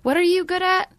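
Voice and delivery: imagined pushy stranger. Acted. Stranger quizzing a non-celebrity on their talents, insistent. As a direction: play a stranger demanding an answer. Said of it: "imagine if somebody came up to you".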